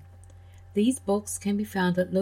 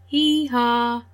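higher, louder hee-haw; donkey bray; 4 semitones higher, 5 dB louder than usual. Acted. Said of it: "The donkey said".

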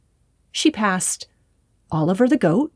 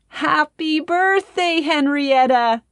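shouted greeting; 8 semitones higher, slower than usual. A narrator's reading. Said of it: "they all shouted together".

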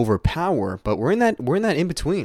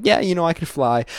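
higher-pitched playful brag; showing off; 8 semitones higher than usual. Acted. New superhero bragging to friends, showing off. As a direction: toss it out as a breezy boast. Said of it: "you're kinda showing off to your friends".

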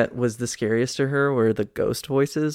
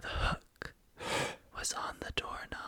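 whisper; 12 dB quieter than usual. Acted. Telling scary story in whisper.

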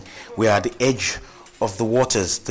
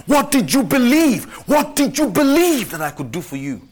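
high, urgent call to believe; rousing preaching; 7 semitones higher, 4 dB louder than usual. Acted. Pastor urging each listener to believe, rousing preaching. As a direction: stir the church to faith.

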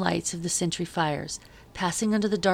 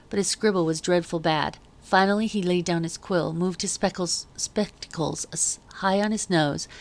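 plain reading voice

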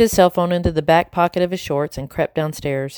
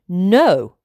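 a louder, firmer second no; firm refusal; 2 semitones higher, 4 dB louder than usual. A narrator's reading. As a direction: refuse flatly, no room to argue. Blue repeats refusal louder.

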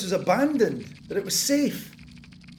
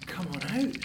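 usual speaking voice

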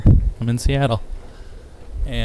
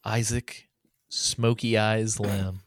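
podcast announcer; 6 dB quieter, much slower than usual. Acted. Hosts podcast intro.

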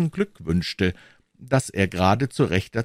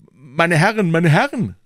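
louder, higher calls to order; urgent raised call; 9 semitones higher, 8 dB louder than usual. A narrator's reading. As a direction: break up a quarrel with a raised voice. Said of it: "rief man ringsumher".

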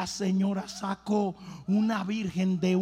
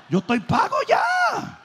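much higher and louder, slow boast; triumphant shout; 5 dB louder, slower than usual. Retold or imitated. Pastor boasts to the doctor he's ready, high slow shout. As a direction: shout it like you've already won.

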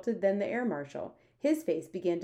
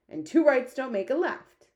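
loud, higher giant's retort; lively cry; 7 semitones higher, 7 dB louder than usual. A narrator's reading. BFG chimes in after the Queen, crying out. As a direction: pipe up loudly. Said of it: "cried the B F G".

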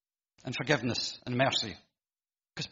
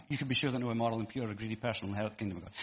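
quick aside; 5 semitones lower, 7 dB quieter, much faster than usual. Retold or imitated. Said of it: "He's not saying".

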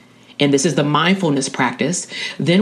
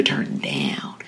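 dropping softly away toward a whisper; hushed wind-down; 13 semitones lower, 8 dB quieter than usual. Real, not acted. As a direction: let the voice sink into a near-whisper. Contrasts turning up with turning down.

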